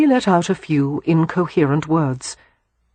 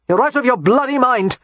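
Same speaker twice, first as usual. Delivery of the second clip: sharp, higher retort; snapped; 8 semitones higher, 3 dB louder than usual. Acted. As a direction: snap back in disbelief. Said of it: "Christopher snapped".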